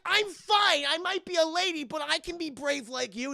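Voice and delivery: high, loud mock indignation; indignant retort. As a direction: protest loudly as an offended heroine. Acts indignant lady rebuffing rescuer.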